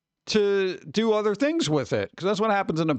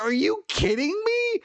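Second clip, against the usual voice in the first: mock outrage; 12 semitones higher than usual. Retold argument with the bank.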